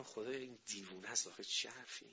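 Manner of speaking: hushed, half-whispered inner thought; murmured disbelief under the breath; whispered aside. Retold or imitated. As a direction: drop the voice right down, as if to yourself.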